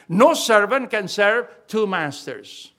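slow, loud reading of the verse; emphatic scripture. Acted. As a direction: proclaim each word of the verse. Preacher reads scripture emphatically.

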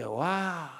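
normal talking voice

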